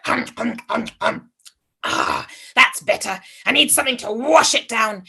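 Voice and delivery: greedy chewing sounds; munch the bread noisily; munching noises. Acted. Old man chewing bread.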